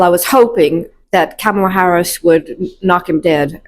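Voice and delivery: normal talking voice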